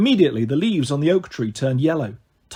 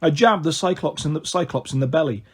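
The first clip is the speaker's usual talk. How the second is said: usual speaking voice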